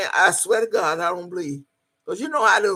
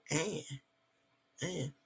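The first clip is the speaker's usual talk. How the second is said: quiet puzzled mumble; 4 semitones lower, 16 dB quieter than usual. Retold or imitated. He repeats the name, puzzled.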